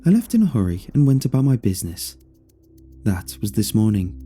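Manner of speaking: usual speaking voice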